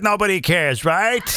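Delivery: normal talking voice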